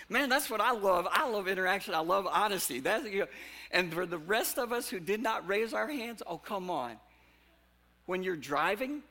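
high, loud, excited preacher voice; rally the room with rising energy; preaching at full force. Real, not acted. Pastor cheering the congregation for raising hands, loud and high.

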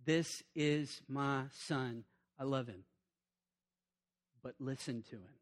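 God declaring from the cloud, hushed. Retold or imitated. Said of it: "they hear God declare".

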